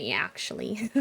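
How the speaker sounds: "actually" with a Midwestern y-glide, "yee-ackshully"; mock Midwestern "actually" starting like "yeah"; Midwestern accent. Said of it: "heard people say".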